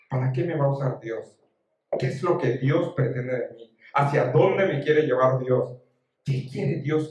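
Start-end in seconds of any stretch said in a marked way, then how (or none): none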